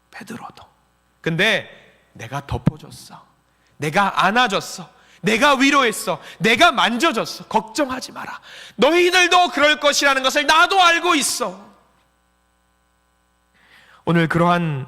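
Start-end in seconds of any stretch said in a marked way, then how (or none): 0.1-0.6 베드로도 whispered, almost no voice; very soft whisper on 베드로도; hushed echo. Acted. Hushed repeat of Peter's name. 1.2-11.7 the Lord consoling. Acted. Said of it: "주님은 우리를 위로하려고 하십니다".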